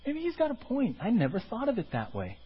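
taken-in listener. Retold or imitated. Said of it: "you're like".

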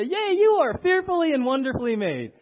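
loud, high, gushing yay; excited cheer. Shown as a demonstration. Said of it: "Turn to the person next to you and say".

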